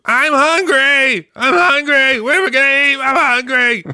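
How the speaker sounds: high, loud whining holler; mock repetitive hungry wail; Moochie. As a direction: holler it like a pestering character, way up high. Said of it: "Moochie continues to do".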